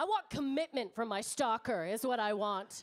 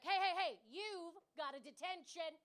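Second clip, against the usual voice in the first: 1 stern teacher; 4 semitones higher, 5 dB quieter than usual. Pretend. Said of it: "lay down the law like a teacher".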